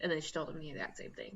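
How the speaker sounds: ordinary delivery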